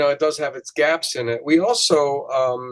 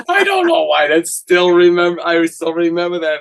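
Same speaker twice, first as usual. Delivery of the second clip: higher, amused through laughter; laughing; 4 semitones higher, 4 dB louder than usual. Real, not acted. Host amused at still remembering that song, laughing. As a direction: talk while laughing at yourself.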